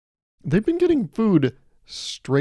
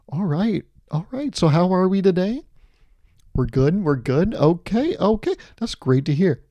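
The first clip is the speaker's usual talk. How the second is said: chirpy upbeat meeting opener, higher; corporate meeting host; 5 semitones higher than usual. Retold or imitated. Comic mocking a boss at a Monday meeting, chirpy and upbeat. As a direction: play the cheery manager opening a meeting. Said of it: "talk like they're in a corporate setting".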